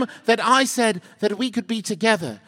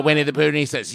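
normal talking voice

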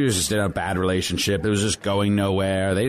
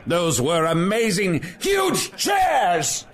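excited shout; 12 semitones higher, slower than usual. Acted. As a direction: shout it in giddy delight. Nostalgic outburst about the chairs.

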